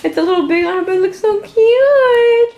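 cooing. Real, not acted. Cooing over dressed-up toddler.

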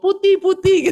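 high, loud teasing cry of a neighbor lady; mocking neighbor. Neighbor women jeering at the boy whose pants were pulled down, mocking high squeal. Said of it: "langsung ngomong".

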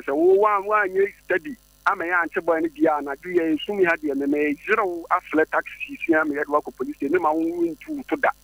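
heated retold threat. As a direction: push it up high like scolding him again.

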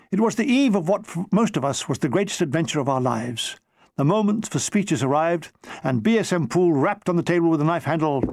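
ordinary delivery, nothing marked

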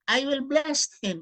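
slow, loud, solemn Bible reading; scripture reading. Acted. Preacher reading the Bible verse aloud to listeners, solemn declaiming. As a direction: proclaim each verse slowly to the audience.

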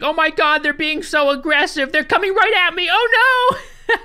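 high, loud hammed-up panic; mock panic. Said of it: "she's like".

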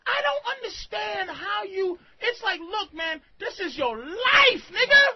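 exasperated outburst. Real, not acted. Host urging caller that this is his life, exasperated. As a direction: plead hard, rising to a shout.

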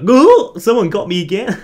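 loud, high startled yelp easing off; mock shock, jumping up in pitch; shocked Magikarp. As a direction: voice a startled fish, hammed up. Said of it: "He looks really shocked".

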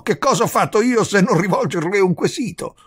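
high, loud, triumphant challenge. A narrator's reading. Visitor justifying his question to the countess, triumphant. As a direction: drive the point home in triumph. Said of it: "in tono di trionfo".

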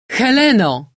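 loud, high call; shouting. A narrator's reading. General calling his daughter from the window, shouting. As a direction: shout the name out into the night. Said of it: "krzyknął".

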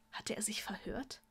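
whispered narration. A narrator's reading. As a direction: drop to a whisper, unsure. Draco doubts what he heard.

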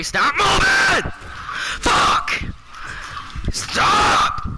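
high, loud exasperated yelling; angry shouting. Real, not acted. Gamer to a moving opponent, angry shouting. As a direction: yell at a target that won't hold still.

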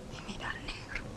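whispered, almost breathless; hushed whisper. Acted. To her man, a whispered appeal. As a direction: drop to a whisper for him.

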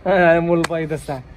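loud call down the street. Acted. Neighbour hails the egg seller across the street, loud call.

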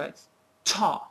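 to with a crisp, strong first sound; strong start. Shown as a demonstration. Teacher models the right way to say it, crisp. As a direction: hit the start of to firmly. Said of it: "a very strong start".

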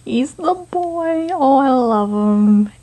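ordinary talk

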